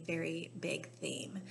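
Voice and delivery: soft breathy whisper. Real, not acted. Teacher whispering to her class, hushed. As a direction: lean in and whisper it.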